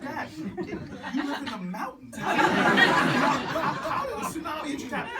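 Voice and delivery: high, laughing disbelief at dad's tale; incredulous disbelief. Retold or imitated. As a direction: laugh it out, you can't believe him. Son challenging father's tall tale.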